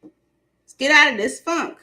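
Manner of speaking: rally the troops like a mock drill sergeant. To the audience, pushing out of the funk like a drill sergeant. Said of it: "it's like drill sergeant".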